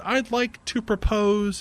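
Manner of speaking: a hammed-up proposal toast; grand announcement. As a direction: announce it to the table slowly. [name]'s formal toast announcement.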